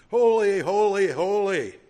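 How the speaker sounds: very loud, high, ringing cry; angels' acclamation. Acted. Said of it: "provoked the angels to sing".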